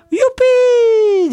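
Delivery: loud very high youpi; cheer. Acted. Family cheering the river trip, joyful. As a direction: shout for joy.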